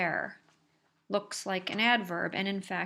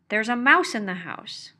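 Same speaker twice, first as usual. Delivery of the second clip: "There's a mouse in the house" said loud with the mouse punched; emphatic there sentence; 2 semitones higher, 9 dB louder than usual. Shown as a demonstration. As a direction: announce the mouse, louder, as an alarm. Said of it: "I want to highlight".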